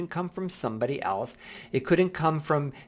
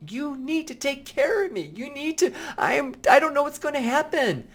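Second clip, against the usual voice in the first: put-on much higher child's voice, pleading; little kid inside; 13 semitones higher than usual. Inner little kid begging to be cared for, high and pleading. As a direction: speak as the scared child within. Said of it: "That little kid inside me is like".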